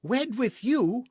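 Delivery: slow, high, loud disbelief; outraged cry. A narrator's reading. Señorita confronts captain with blazing eyes, outraged cry. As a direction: fling the question at him in scorn. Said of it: "she cried".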